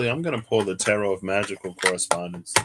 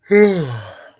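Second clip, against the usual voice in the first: yawn; 7 semitones higher, 12 dB louder than usual. Real, not acted. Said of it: "Excuse me".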